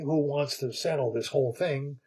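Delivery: plain reading voice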